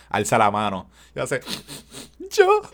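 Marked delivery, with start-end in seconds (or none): 2.3-2.6 high squeaky little voice; weepy volunteer. Retold or imitated. Crying girl volunteering for the sacrifice, squeaky high. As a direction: squeak it out while weeping.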